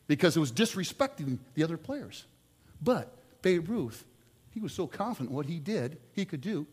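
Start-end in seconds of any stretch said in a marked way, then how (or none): none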